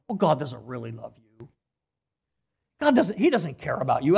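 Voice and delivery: hint of the tempting devil; devil's lie. The devil lying to a suffering believer, low and sly. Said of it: "First thing he says is".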